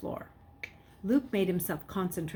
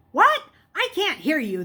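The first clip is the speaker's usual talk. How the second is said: much higher, louder shout down the line; yell; 9 semitones higher, 7 dB louder than usual. A narrator's reading. Police officer yelling over a bad connection. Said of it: "the man yelled".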